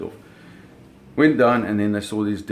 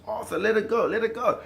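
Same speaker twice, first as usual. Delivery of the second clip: high pleading yell, pitched way up; urgent retold shout; 12 semitones higher than usual. Father begging Arthur to drop the cable, high yell. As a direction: repeat the warning, higher and urgent. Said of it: "My, my father says".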